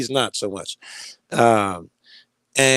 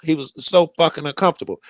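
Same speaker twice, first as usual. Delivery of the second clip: ordinary delivery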